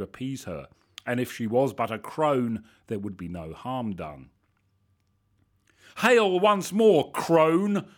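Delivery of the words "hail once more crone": loud, high, slow declamation; declaimed. A narrator's reading. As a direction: proclaim it grandly and slowly. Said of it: "I declaimed".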